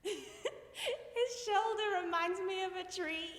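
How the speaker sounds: high, slow, laughing through the words; squeaky, embarrassed fond laughter; giggly confession. Acted. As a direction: dissolve into giggles as you admit it.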